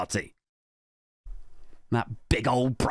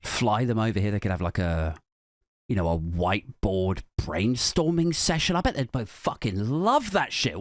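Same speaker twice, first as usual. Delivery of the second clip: ordinary talk, nothing marked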